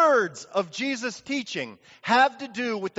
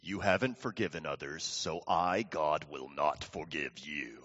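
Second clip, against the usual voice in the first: hushed, deep mock-divine verdict; stern voice of God; 10 dB quieter than usual. Preacher voices God refusing forgiveness, low and stern.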